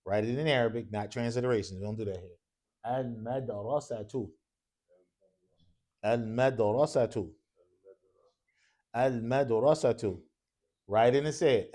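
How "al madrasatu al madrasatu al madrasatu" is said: slow, evenly repeated word for writing down; slow dictation. Shown as a demonstration. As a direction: dictate it slowly, syllable by syllable. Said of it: "Keep saying it and writing it".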